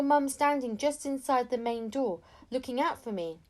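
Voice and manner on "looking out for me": usual speaking voice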